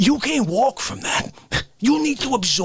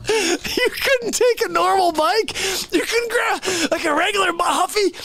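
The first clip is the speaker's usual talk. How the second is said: laughing disbelief; 10 semitones higher than usual. Real, not acted. Mocking the friend's circus bike, high and laughing.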